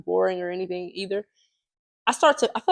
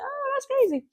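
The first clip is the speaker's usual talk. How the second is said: surprised quote; 4 dB quieter than usual. Retold or imitated. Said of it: "And I was like".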